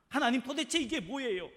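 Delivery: high, loud challenge flung at God; indignant demand. Acted. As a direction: demand an answer, aggrieved. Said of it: "하면서 하나님 앞에 따집니다".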